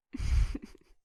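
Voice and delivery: loud sudden scoffing laugh; sharp mocking laugh. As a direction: burst into a scornful laugh. Interrogator laughs at suspect's point.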